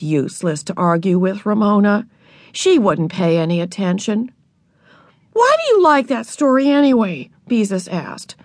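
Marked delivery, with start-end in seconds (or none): 5.3-7.3 higher, exasperated question. A narrator's reading. Older sister questions little sister's taste, exasperated. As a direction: ask it in exasperation.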